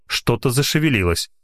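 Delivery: plain reading voice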